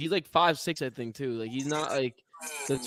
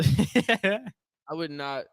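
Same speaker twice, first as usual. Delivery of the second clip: slow refusal; much slower than usual. Real, not acted. Mock refusal, drawn out.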